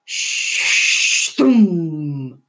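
a narrator voicing an incoming missile strike; missile whoosh and boom. A narrator's reading. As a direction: make the sound of the missile hitting. Imitated missile impact.

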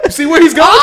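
high-pitched laughing; squeaky laugh breaking through. Real, not acted. Laughing at friend.

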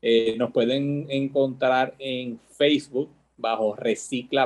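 normal talking voice

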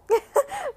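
high laugh; quick high laugh. Real, not acted. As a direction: burst into a giggle. Laughing at own joke.

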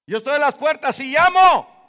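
preacher roaring the verse at full force; booming proclamation. Shown as a demonstration. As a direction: proclaim it to the whole room.

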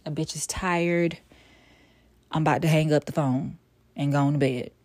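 ordinary delivery

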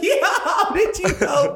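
high squealing laugh through the words. Retold or imitated. Laughing while retelling her reply.

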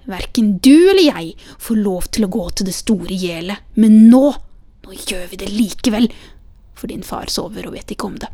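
scornful retort with emphatic loud peaks; scoff, punch key words, then drop off; scornful old woman. A narrator's reading. Grandmother dismisses the child's warning about the forbidden place, scornfully. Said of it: "Den gamle svarte foraktelig".

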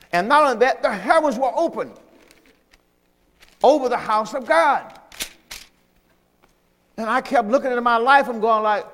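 usual speaking voice

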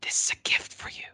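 a soft voiceless whisper of a small lizard. A narrator's reading. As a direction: breathe it out, barely voiced. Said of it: "the lizard whispered".

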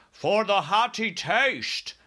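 high, slow, loud interjection; BFG crying out. A narrator's reading. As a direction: butt in with a drawn-out giant's cry. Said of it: "cried the B F G".

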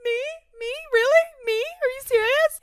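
high, loud squawking "Me?"; Nemo seagull. Retold or imitated. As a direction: shriek it like the Nemo gulls. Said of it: "sounded like the bird from Nemo".

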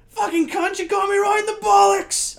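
high, loud mock yelp of pain; exaggerated high-pitched cry of outrage; awful put-on shout. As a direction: yell it in a badly hammed-up voice. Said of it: "Oh, that was awful".

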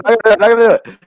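emphatic laughing no; laughing loud refusal. Real, not acted. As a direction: insist loudly through a laugh. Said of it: "this sounds racist".

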